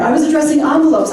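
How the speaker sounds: excited. Real, not acted. Candidate spots her volunteer in the crowd, excited. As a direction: toss it out with eager energy. Repeats it louder, faster, higher.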